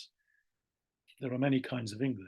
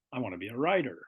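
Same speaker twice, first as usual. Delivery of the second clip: American accent, "writer" turned into "rider"; faster than usual. Shown as a demonstration.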